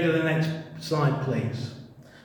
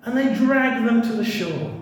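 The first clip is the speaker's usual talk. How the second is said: ordinary talk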